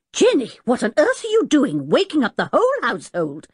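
high-pitched, startled telling-off; exasperated scolding. A narrator's reading. Professor woken by his screaming maid, exasperated scolding. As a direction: scold with astonished emphasis.

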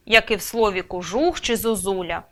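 usual speaking voice

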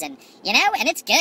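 ordinary talk, nothing marked

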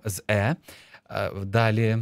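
ordinary talk

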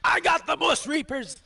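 high-pitched yelled brag; shouted boast. Real, not acted. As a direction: cut through the squabble, voice way up. Boasting over squabbling teammates.

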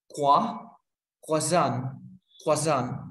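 croissant said kwa-SAHN, French style; croissant with the French quoi at the start. Shown as a demonstration. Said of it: "So we say".

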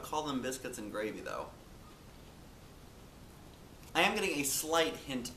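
talking while chewing crisps; crunching a snack mid-sentence; mouth full of chips. Real, not acted. Seen 0: Bites chip at +0.2, then chews looking up; still munching after.

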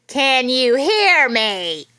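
loud, drawn-out shouted question; slow loud call. Acted. Nurse shouting at a kid during hearing test, slow and loud. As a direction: shout it slowly like she's testing deaf ears.

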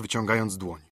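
ordinary delivery

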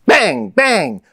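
two sharp high exclamations; imitated gunshots. A narrator's reading. Narrator as shots surprise the camp, high exclaimed bangs. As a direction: burst out twice like gun shots.

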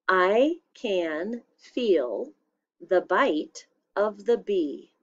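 I can feel the bite of the bee, read slowly for dictation. Shown as a demonstration. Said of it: "Here's your sentence".

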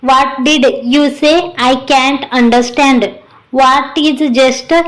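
very slow, word-by-word puzzled English; foreigner's halting English. Acted. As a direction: ask slowly, groping for each English word. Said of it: "आपल्या इंग्लिशमध्ये सत्याला विचारत". (अडखळत, खूप हळू इंग्रजीत गोंधळून विचारणे)

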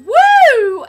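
loud, high "woo"; whooping cheer. Real, not acted. Celebrating a cool week, whooping cheer. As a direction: let out a big celebratory whoop.

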